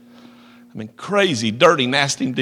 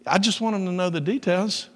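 higher, gentle loving tone; said in love; 5 semitones higher than usual. Retold or imitated. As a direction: demonstrate the kindly softened version. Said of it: "Say it in love".

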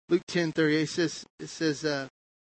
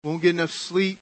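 normal talking voice

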